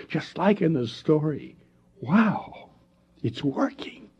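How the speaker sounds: delighted. Acted. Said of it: "grinning with delight".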